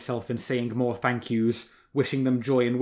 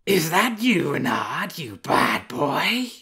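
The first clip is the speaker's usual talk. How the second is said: higher, slow, fond scolding of a she-wolf; soft she-wolf voice; 5 semitones higher, 6 dB louder, slower than usual. A narrator's reading. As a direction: tease the fox gently as a she-wolf. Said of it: "A wolf's voice again, but softer this time".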